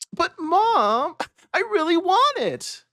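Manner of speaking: put-on high begging kid voice; a little boy pleading for a toy; whiny child. Child whining to his mother for the toy.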